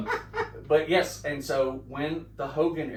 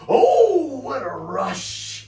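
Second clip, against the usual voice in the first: loud, much higher "Oh", then a very slow stretched "what a rush"; catchphrase bellow; 13 semitones higher, 11 dB louder, much slower than usual. Retold or imitated. Hawk yells his catchphrase at the brothers, a slow booming bellow. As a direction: belt it out like a wrestler hamming for TV.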